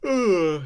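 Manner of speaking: big loud groaning yawn; a real yawn breaking into the story. Real, not acted. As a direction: yawn out loud mid-reading. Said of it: "you really emphasised the yawn".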